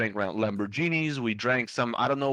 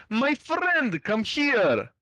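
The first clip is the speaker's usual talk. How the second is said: much higher, imitating a Russian-sounding accent; 10 semitones higher, much slower than usual. Beach boy calling the narrator over, in a high Russian-sounding accent. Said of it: "I'm gonna completely replicate the accent".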